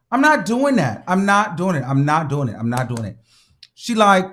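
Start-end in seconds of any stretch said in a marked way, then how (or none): 0.1-3.2 repeated no, trailing off; fading refusal. Real, not acted. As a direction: let the refusal die away. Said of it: "repeated refusal fading".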